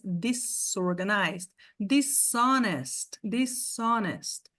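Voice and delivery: Disorganized and dishonest slowed right down, with a hard S; slow English demo. Shown as a demonstration. Teacher drilling words with a hard S. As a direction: say each slowly with a crisp S. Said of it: "o S tem som de S".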